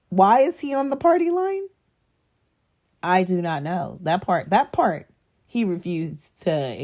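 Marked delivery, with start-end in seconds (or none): 0.1-1.7 higher, louder why on the party line; raised, incredulous question. Real, not acted. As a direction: ask it in loud mock disbelief. Mocking question to the audience.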